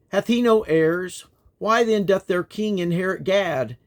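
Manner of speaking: ordinary talk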